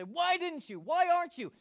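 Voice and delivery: high, loud protest to God; indignant complaint. Acted. Upset believers demanding answers from God, indignant. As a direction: wail the questions in exasperation.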